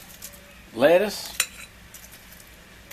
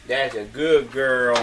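normal talking voice

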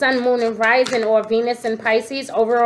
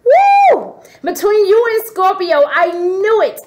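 big, very high, loud woo that settles into a loud, high, excited claim; triumphant squeal; thrilled whoop; 6 semitones higher, 5 dB louder than usual. Real, not acted. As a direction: shriek with delight at being proven right. Realizes energy was Pisces.

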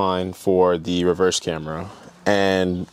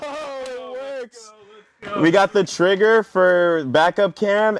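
high, slow, hushed wonder; delighted surprise; 12 semitones higher, slower than usual. Real, not acted. Host sees the backup camera come on, slow high amazement.

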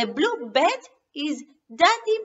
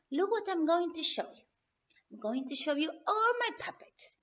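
plain reading voice

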